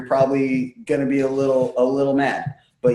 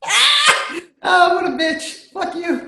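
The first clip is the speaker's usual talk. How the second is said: shocked squeal; slower than usual. Real, not acted. Shocked outburst at fart news.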